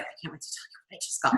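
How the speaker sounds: breathy whisper to her sister. Retold or imitated. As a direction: hush it, barely voiced, so nobody hears. Whispering beside sister after meditation.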